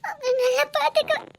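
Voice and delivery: relieved, tearful laugh; laughing through tears. Acted. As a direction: promise tenderly while half laughing, half crying. Wife relieved, laughing and crying.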